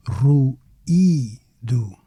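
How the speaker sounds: "ruído" slowly as ru, EE, do with pauses; separated vowels. Shown as a demonstration. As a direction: break "ruído" into three syllables. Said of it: "Not ruído, ruído".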